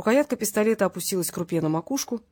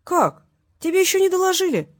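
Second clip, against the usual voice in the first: high, loud feigned amazement; exaggerated, high-pitched incredulity; mock surprise; 11 semitones higher, 6 dB louder than usual. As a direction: act astonished to taunt him.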